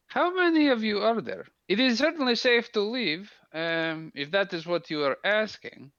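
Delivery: a lightly voiced soldier, formal and friendly; Minx in character. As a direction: speak as Minx, stiff and formal.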